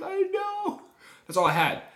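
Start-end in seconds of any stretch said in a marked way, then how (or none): 0.0-0.8 put-on whiny kid. His kid self answering mom.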